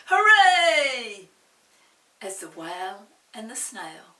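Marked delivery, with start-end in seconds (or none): 0.1-1.3 loud high cheer; shouting hooray. Acted. Villagers cheering the rescued whale, loud high cheer. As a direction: cheer like a whole crowd. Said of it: "villagers shouting".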